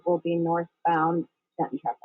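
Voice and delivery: usual speaking voice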